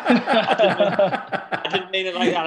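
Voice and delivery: laughing through the words, slow; laughing protest. Real, not acted. To the lads after a cheeky slip, laughing. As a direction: protest while cracking up.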